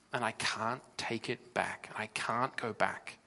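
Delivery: quiet regret. Acted. Voiced regretful sinner.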